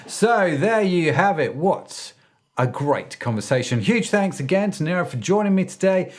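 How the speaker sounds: lively host sign-off with swooping pitch; podcast outro. Acted. Host wraps up the interview for listeners, animated podcast outro. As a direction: present it to the listeners, punching the openers.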